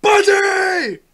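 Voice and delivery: very high, blaring held yell; mock roll-call scream. Friend playing the screaming counselor, mock scream.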